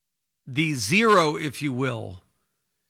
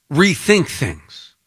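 normal talking voice

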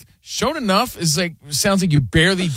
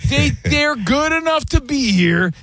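condescending; 9 semitones higher than usual. Retold or imitated. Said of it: "That's meant to be like".

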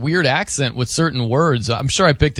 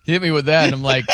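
usual speaking voice

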